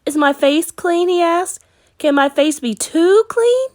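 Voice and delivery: put-on small boy's voice, high and eager; young Caleb. A narrator's reading. Caleb asking his sister, high child voice.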